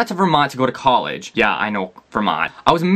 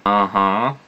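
lower, unimpressed brush-off; dismissive brush-off; 6 semitones lower, much slower than usual. Retold or imitated. Coffee shop employee to a chatty stranger, lower and dismissive. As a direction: shrug it off like a bored barista. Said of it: "will get you a weird look".

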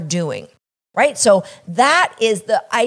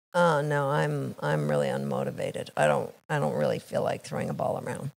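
low, much softer, mumbled reluctance; unmotivated; 9 semitones lower, 12 dB quieter, faster than usual. Acted. Unmotivated friend turns down the game, low and much softer. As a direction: sound like you can't be bothered to get up. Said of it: "from unmotivated to comfortable".

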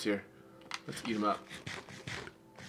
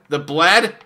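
loud high yell on "bled"; 8 semitones higher, 17 dB louder than usual. Acted. Last take yelled.

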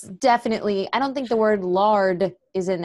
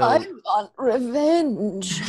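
put-on slow, croaky old man voice; creaky elderly villain; old person voice; much slower than usual. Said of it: "your old person voice sounds like".

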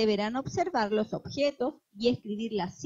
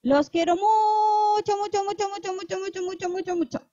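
gushing love; 9 semitones higher, 4 dB louder than usual. Acted. Tiny teacher telling children she loves them, high then fading. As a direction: pour out love, then let the muchos trail away.

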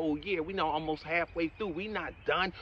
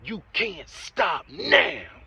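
slow, shouted, every word hammered; urging listeners not to give up, shouting slowly; emphatic shout; 6 dB louder, much slower than usual. Real, not acted. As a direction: shout it so they won't quit. Motivational speaker urging listeners on.

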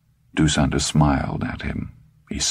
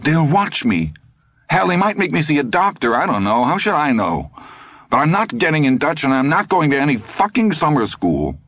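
put-on higher, fast panicky boy's voice; a cornered kid talking himself up; agitated teenage boy; 11 semitones higher than usual. A narrator's reading. Todd frets to the old man, high and fast.

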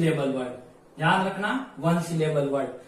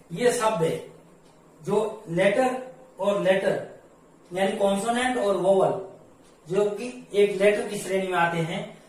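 ordinary talk, nothing marked

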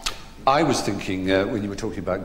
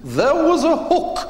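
much higher, slow, grandly unbothered delivery; grand old actress; 6 dB louder, slower than usual. Retold or imitated. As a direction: deliver the punchline like a lofty old theatre dame. Punchline, Edith explaining the painting.

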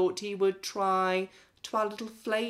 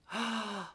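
mock gasp; 6 semitones higher, 7 dB quieter than usual. A narrator's reading. Gasp before the gross reveal.

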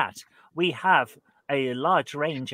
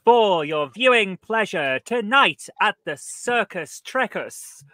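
show announcer; 2 semitones higher, 5 dB louder than usual. Acted. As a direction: announce the gladiators to the crowd. Ring announcer opens the quiz show.

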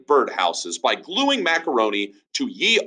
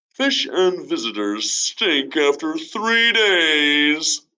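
Ben's sayings; 6 semitones higher, slower than usual. Acted. Ben giving sayings.

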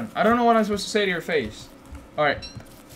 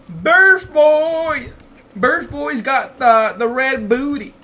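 hype call; 6 semitones higher, 5 dB louder, much slower than usual. Acted. Hypes his in-game creatures.

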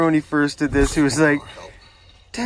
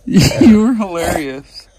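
ordinary talk, nothing marked

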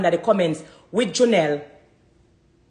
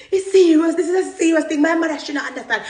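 higher, louder, really means it; emphatic and higher; insistent; 8 semitones higher, 3 dB louder than usual. Real, not acted. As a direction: press the point hard. Insisting, rising.

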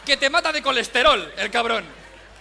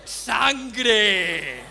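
yelling; 4 semitones lower than usual. Retold or imitated. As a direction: bellow it like a maniac. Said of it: "Va gritando".

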